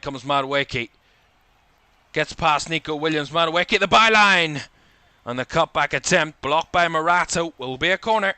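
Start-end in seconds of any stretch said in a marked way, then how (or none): 2.1-8.3 building commentary, louder and higher as he reaches the byline; rising excitement. Real, not acted. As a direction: lift the voice as the attack builds, peaking at the byline. Attack down the right, commentator excitement.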